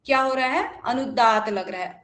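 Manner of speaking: usual speaking voice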